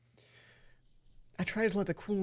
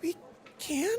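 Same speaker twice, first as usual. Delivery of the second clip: higher, rising, can she believe it; pitched-up disbelieving We can; hopeful surprise; 10 semitones higher than usual. Acted. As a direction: ask in teary hope.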